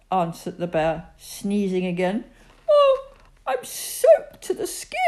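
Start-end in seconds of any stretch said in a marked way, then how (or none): 2.7-5.0 high, loud, weepy moan; whiny and teary. A narrator's reading. Soaked Bertie moaning to Teddy and Daddy, a high, weepy whine. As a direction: whimper about being soaked.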